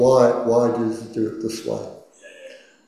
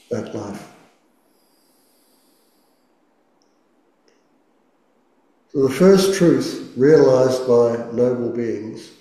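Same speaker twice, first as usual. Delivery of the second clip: guided meditation. Acted. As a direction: gently guide the listener into stillness. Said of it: "let's do our first meditation".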